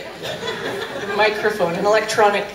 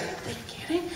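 incredulous whisper; 7 semitones higher, 14 dB quieter than usual. Retold or imitated. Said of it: "anyone in close proximity is like".

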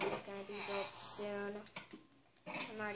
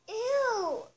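loud high "Ew", grossed out; disgusted shriek; 10 semitones higher, 8 dB louder than usual. Real, not acted. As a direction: recoil with a yelp. Discovers poo.

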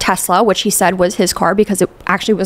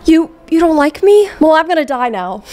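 higher, whiny mock sulk; mock guilt-trip; 7 semitones higher than usual. Mimicking the liar guilt-tripping his crush, whiny and high. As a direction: pretend to be the wounded suitor.

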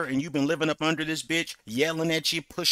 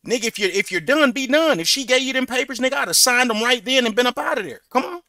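heated raised voice; 8 semitones higher, 5 dB louder than usual. Real, not acted. Heated advice to guest.

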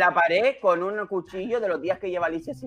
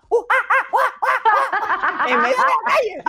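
high hooting monkey calls; monkey noises; 4 dB louder than usual. Retold or imitated. Host imitating Alicia hooting like a monkey in the street. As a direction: hoot like the Lion King monkey, loud and high. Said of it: "Y empieza".

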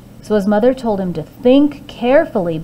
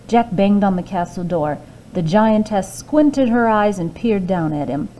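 ordinary delivery, nothing marked

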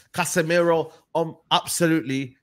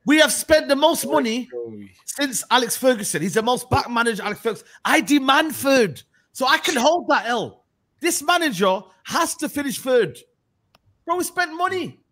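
higher and louder, worked up; heated rant; 7 semitones higher, 3 dB louder than usual. Real, not acted. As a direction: rant about the money spent. Heated rant about spending.

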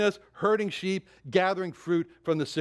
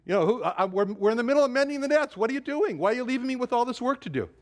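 higher, protesting father calling after his sons; imitating the father; 7 semitones higher, faster than usual. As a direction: plead like a father left alone with the nets, faster at the end. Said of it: "can't you hear the father saying".

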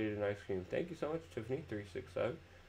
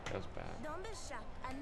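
pained squeal; 7 dB quieter, much slower than usual. Real, not acted. Reacting to a painful in-game moment.

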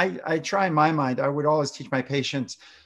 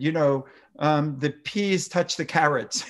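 ordinary talk, nothing marked

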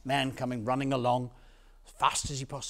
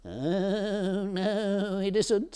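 higher, wavering bleat; talking goat; 5 semitones higher, much slower than usual. Acted. Goat contradicts the shepherd, bleating voice. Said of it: "said one of the goats".

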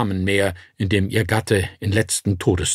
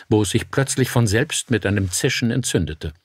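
plain reading voice

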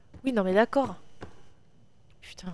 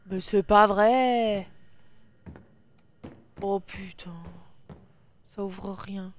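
drawn-out despair; 3 semitones lower, much slower than usual. Real, not acted. Puzzle fails again, despairing.

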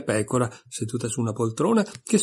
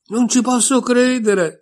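much higher, louder, slower cry of disbelief; incredulous exclamation; 12 semitones higher, 7 dB louder, slower than usual. A narrator's reading. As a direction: gasp it out as if it cannot be true. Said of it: "esclamò Alice".

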